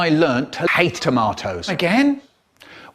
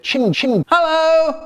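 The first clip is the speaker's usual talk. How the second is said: high, silly cartoon train voice; talking train voice; 13 semitones higher, 3 dB louder, slower than usual. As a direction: squeak it out like a toy train.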